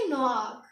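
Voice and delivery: नोआक as French noir, with a throaty final r; French pronunciation of नोआक. Shown as a demonstration. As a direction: end नोआक on a rasped French r. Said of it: "हम क्या बोलेंगे?".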